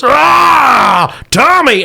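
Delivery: loud, high mutant yell; a brute roaring as it lifts its prey. Acted. Mutant lifts Hank overhead and roars, loud and high.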